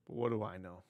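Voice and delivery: low, soft throwaway question; muted aside. Real, not acted. Host doubting his own take on celebrities' cash, muted aside. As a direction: toss it off quietly, low in the voice.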